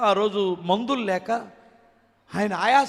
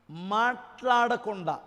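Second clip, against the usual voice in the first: ordinary delivery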